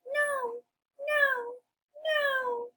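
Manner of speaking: very high, squeaky little pig; high-pitched piggy voice. Acted. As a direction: squeal a refusal as a tiny pig. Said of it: "Each pig says".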